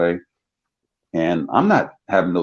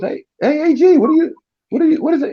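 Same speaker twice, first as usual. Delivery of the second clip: surprised coach; faster than usual. Retold or imitated. Coach surprised to see him.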